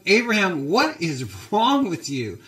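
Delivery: angry Pharaoh. Acted. Pharaoh confronting Abraham over his lie, angry. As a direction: play an indignant ruler telling someone off. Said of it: "Pharaoh's angry. He's going".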